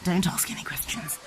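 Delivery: whispered, conspiratorial aside; mock secret whisper. Mother joking to her child in a conspiratorial whisper.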